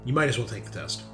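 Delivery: ordinary delivery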